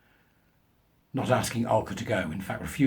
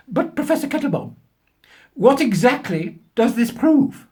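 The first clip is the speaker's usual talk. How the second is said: higher, louder, slow puzzled question; young woman from the crowd; 12 semitones higher, 7 dB louder, slower than usual. Pretend. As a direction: voice a baffled young woman asking the professor.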